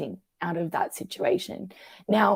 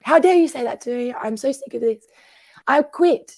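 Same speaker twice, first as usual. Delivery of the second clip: acted-out indignation, higher and louder; indignant outburst; 5 semitones higher, 6 dB louder than usual. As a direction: fire back at an abusive boss. Acted angry reply to abusive boss.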